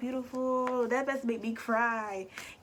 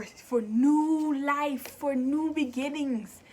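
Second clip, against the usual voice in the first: drawn out; 3 semitones higher, 4 dB louder, much slower than usual. Real, not acted. Dwells on the words.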